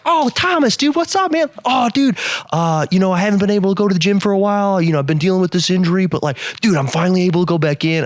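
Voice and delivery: high, fast, chatty and buddy-like; opening up eagerly. Retold or imitated. Customer opening up to a friendly coworker, high and fast. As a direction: spill it all out like meeting a friend. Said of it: "he just opened up immediately".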